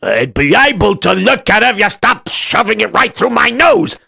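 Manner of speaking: irritated captain. Acted. Irritated captain snaps back.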